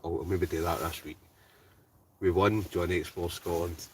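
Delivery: usual speaking voice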